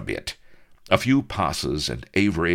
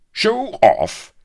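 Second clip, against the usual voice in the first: high, loud indignant outburst; captain echoing the insult in outrage; stung cry; 10 dB louder than usual. A narrator's reading. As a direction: shoot back, stung and offended. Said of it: "cried Avery, stung".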